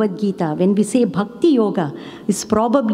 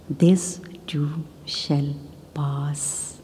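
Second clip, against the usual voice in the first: slow, hushed line ending on a long "pass"; word by word, sinking softly into a drawn-out "pass"; stress on PASS; 4 semitones lower, 7 dB quieter, much slower than usual. Shown as a demonstration. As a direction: set "pass" apart after a pause, almost whispered. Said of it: "emphasis on each word".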